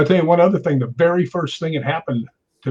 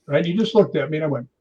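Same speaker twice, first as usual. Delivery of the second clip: usual speaking voice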